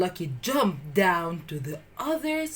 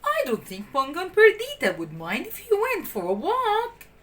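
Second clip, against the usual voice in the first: higher, gently reassuring nanny; nanny granting the walk; kindly Nanny; 8 semitones higher than usual. A narrator's reading. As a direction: coax the pups along. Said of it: "she said".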